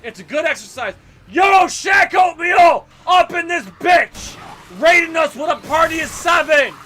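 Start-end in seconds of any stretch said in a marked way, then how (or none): 1.3-6.7 loud, drawn-out higher hype yell; hyped shout. Real, not acted. Greeting a player named in game, loud hype shout. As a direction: call it out like a hype man.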